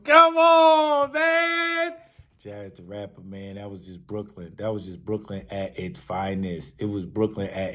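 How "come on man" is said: high, loud, drawn-out reaction; hyped disbelief. Real, not acted. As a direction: stretch it out high and loud, blown away. Reacts to the clip's bars.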